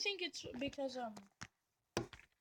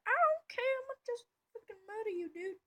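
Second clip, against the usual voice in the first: much higher, fast mock taunt; cocky attacker; 10 semitones higher, 4 dB louder than usual. The attacker taunts the defender in a high fast voice. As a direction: sneer it out like a reckless raider. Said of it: "He's like".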